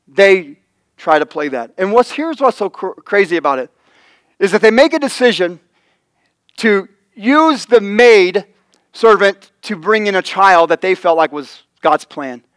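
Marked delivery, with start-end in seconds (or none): none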